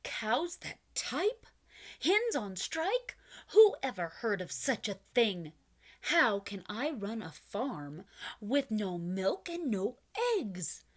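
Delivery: furious. Acted. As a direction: sputter with outrage. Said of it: "Farmer Brown was furious".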